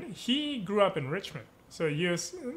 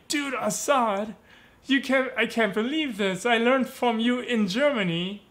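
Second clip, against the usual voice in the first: higher-pitched, astonished retelling of a text; amazed disbelief; 6 semitones higher, 3 dB louder than usual. Retold or imitated. Dancer texts the teacher he learned from, amazed disbelief. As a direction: marvel at the coincidence, stretching the friend's name. Texting his amazed discovery to friend.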